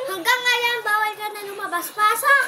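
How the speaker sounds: loud shouted get inside; angry order. Pretend. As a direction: bark it at the child.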